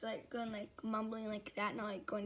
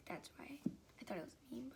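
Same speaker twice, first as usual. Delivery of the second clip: much softer, whispered aside; whispered admission of being dumb; embarrassed whisper; 9 dB quieter, much faster than usual. Real, not acted. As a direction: drop to a sheepish whisper.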